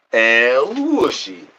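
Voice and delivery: slow, high, stretched call of El Wushy; drawn-out call to a pet; cat-calling sing-song. Acted. Calling the cat in a long high sing-song.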